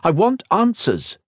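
high, slow, insistent demand; sharp. A narrator's reading. Kat demanding answers from Kira, sharp. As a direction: bear down sharply on each word. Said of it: "she responded sharply".

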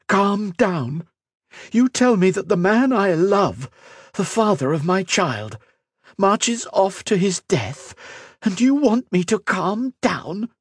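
high, outraged disbelief; incredulous anger. Acted. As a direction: hurl it back at her. Furious disbelieving retort.

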